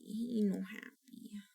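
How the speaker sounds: put-on slow, soft whispered baby talk. Repeating her baby-talk complaint to viewers, a slow whisper. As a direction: whisper it like a sulky secret.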